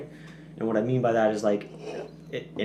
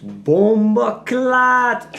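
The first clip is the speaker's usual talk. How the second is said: drawn-out, much higher and louder "Bomba clot!"; mock patois curse; 12 semitones higher, 11 dB louder, much slower than usual. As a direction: belt out "Bomba clot!" like a playful Jamaican curse. Exclaims the patois curse word.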